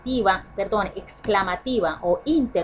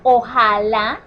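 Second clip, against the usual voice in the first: ojalá said louder, rising at the end; stress on ojalá; 7 dB louder, much slower than usual. Shown as a demonstration. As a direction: lean hard on ojalá and let it lift.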